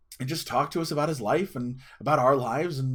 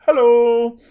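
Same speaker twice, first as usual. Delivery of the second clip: loud, high, held hail; calling across room; 11 semitones higher, 15 dB louder than usual. Retold or imitated. Actor hailing a kid across the room, loud call. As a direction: holler a greeting across the room. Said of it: "from across the room".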